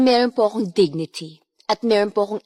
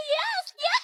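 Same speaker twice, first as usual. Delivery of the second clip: manic happy laugh. Pretend. Auditioning actress acting happy for the casting panel, shrieking high laugh. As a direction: burst out laughing on command, way up high. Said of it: "Act, uh, a happy person".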